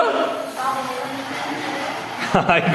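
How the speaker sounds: high giggling laugh; a burst of delighted laughter; real laugh. Real, not acted. Mother and son chatting, laughing.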